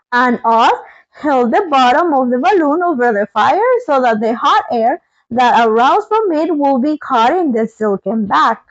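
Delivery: usual speaking voice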